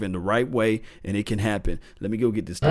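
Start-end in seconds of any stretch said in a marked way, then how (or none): none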